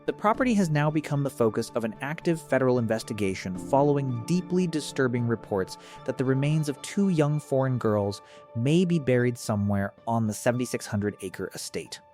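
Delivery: ordinary talk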